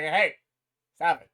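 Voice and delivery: sharp loud call to stop them; retold order, called out to break it up; loud, stern, shouting them down. [name] tells agents stop.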